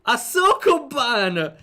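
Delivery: excited emphasis. Real, not acted. Explaining the game mechanic.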